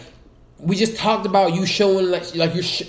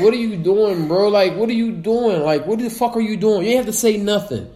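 exasperated rant; 6 semitones higher than usual. Acted. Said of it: "ranting at Nicki".

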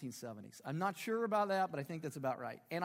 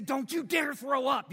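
slow, loud, stern mock shout of a mother; retold mom shout; 6 semitones higher, 8 dB louder, slower than usual. Mom to gagging son at the table, slow mock shout. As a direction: bark it like a fed-up mom, slowly. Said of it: "And then she'd say".

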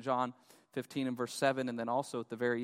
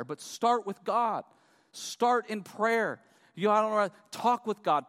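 raised, louder, urgent preacher voice; impassioned preaching; 7 semitones higher, 6 dB louder than usual. Acted. Preacher urging congregation to talk to God, impassioned. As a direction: press each phrase on the congregation, high and strong.

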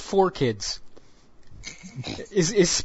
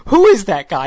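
ordinary talk

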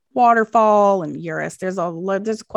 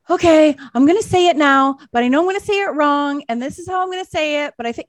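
higher-pitched mock podcaster announcing an attempt; podcaster imitation; 5 semitones higher, 4 dB louder, faster than usual. Podcaster warning listeners about a wrong pronunciation, mock fussing. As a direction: imitate a fussy podcaster stalling over a hard word. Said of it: "and then they'll say".

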